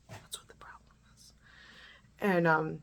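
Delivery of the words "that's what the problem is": barely audible whisper; retold angry retort. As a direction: repeat the comeback in a faint whisper. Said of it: "I'm angry, right?".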